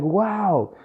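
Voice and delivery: high-pitched, impressed "wow"; retold amazement. New salesman hearing his mentor's advice, amazed. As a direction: replay being impressed, pitched well up.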